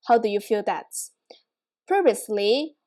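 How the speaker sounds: usual speaking voice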